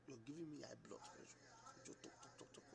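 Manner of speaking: hushed whisper, barely voiced; whispered complaint. Husband hushing his quarreling wife in a whisper. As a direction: drop to a whisper to calm her.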